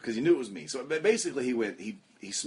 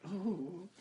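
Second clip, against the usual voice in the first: soft high sigh of relief; pleasure moan; 8 semitones higher, 7 dB quieter than usual. Retold or imitated. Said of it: "this is the noise I made".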